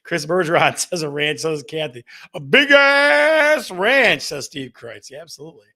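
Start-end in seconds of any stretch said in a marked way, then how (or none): 2.3-4.2 very slow, much higher and louder, stretched out; booming mock emphasis; mock big voice. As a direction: ham up the listener's answer like a boast. Reading out a listener's reply.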